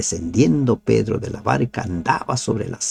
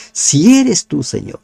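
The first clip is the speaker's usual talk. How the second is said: confident call; 5 semitones higher, 6 dB louder than usual. Shown as a demonstration. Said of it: "Y confirmó que era el maestro".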